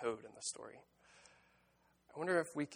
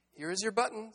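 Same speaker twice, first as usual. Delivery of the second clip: high, eager storybook Frog; Frog calling out; 9 semitones higher, 3 dB louder than usual. A narrator's reading. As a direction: announce a find in a raised, high pitch. Story Frog announces a find.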